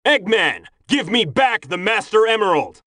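high, forceful call to an enemy; indignant, commanding cry; angry demand. Acted. Demands the emerald from his enemy.

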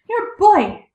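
high, loud accusing cry; crying out loudly. A narrator's reading. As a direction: cry it out in alarm, short of a scream. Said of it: "with loud screams".

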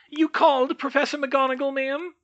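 very high elf voice; high-pitched, polite elf query; dutiful house elf. A narrator's reading. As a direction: squeak it like a deferential servant elf.

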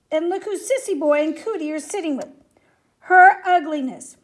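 put-on mean boy's loud announcement, building at the end; trumpeting. A narrator's reading. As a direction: trumpet the jeer, hardest on the last words. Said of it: "The mean boy trumpeted".

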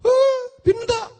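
put-on very high, drawn-out little-kid yes; eager child. Child agreeing to the beggar's swap, eager high voice.

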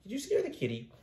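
soft high pet voice. Real, not acted. Baby talk to cat.

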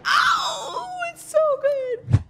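excited. Real, not acted. Amazed by the shotgun play.

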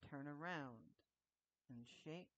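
creepy stranger voice. Acted. Voicing a character in game.

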